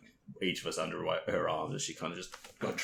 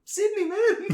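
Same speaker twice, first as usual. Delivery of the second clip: laughing; 7 dB louder, slower than usual. Retold or imitated. Own reply dissolving into laughter.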